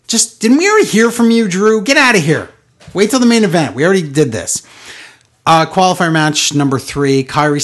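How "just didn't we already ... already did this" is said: high incredulous "what", then a fed-up brush-off; mock-annoyed telling off; exasperated retort. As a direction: scoff at him to get out. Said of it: "And it's like".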